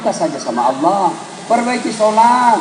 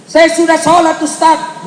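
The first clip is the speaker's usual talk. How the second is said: a higher, louder protesting reply; defensive congregant; 8 semitones higher, 4 dB louder, slower than usual. Retold or imitated. Congregant telling the preacher he already prays, mild protest. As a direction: insist like a churchgoer caught out.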